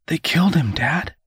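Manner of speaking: high, breaking whisper; whisper, voice cracking. A narrator's reading. Son telling his father of the killing, cracking whisper. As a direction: whisper it high, on the edge of tears. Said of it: "whispered back, his voice cracking".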